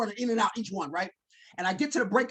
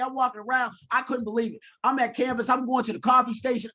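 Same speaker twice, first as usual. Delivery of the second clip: ordinary delivery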